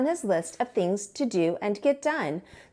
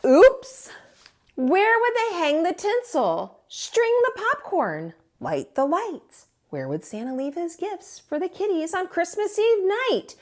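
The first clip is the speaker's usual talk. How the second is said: dismayed moose; 8 semitones higher than usual. A narrator's reading. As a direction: panic about everything missing. Moose dismayed about the tree.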